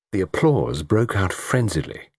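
usual speaking voice

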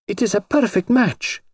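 put-on high, thrilled young princess; delighted. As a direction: gush with delight. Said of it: "she cried delightedly".

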